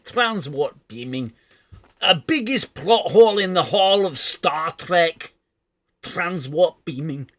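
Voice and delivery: slow, loud, high mock Scottish; outraged Scottish engineer, badly faked; bad Scotty impression. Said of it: "that was a really bad Scotty impression".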